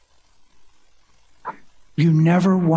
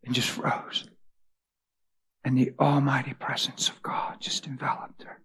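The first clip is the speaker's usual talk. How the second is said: awed, almost silent telling; hushed whisper; 2 semitones lower, 10 dB quieter than usual. A narrator's reading. Preacher to congregation, awed whisper. Awe-struck retelling of God's presence.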